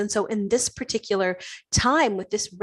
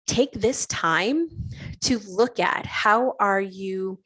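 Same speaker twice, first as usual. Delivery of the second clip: slow emphasis; slower than usual. Real, not acted. Slowing for emphasis.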